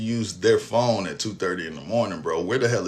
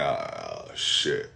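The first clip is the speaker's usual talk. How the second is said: low, soft, dazed; low stunned mutter; 9 dB quieter than usual. Retold or imitated. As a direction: breathe it out as it sinks in. Realizing he was shot.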